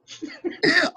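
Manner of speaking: high, stifled laughing fit. Real, not acted. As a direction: laugh before you can get the words out. Laughs before retelling knockout.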